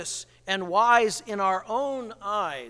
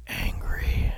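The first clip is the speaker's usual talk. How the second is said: whispered. Acted. Narrator whispers the word.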